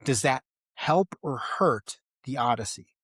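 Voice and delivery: ordinary delivery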